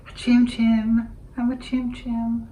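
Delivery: squeaky high little critter voice; cute creature voice. Pretend. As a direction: play a tiny desert critter. Playful Chim Chim creature voice.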